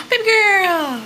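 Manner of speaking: high, loud, drawn-out coo; gushing pet talk. Real, not acted. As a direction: greet the dog with a long, gushing cry. Cooing to pet dog.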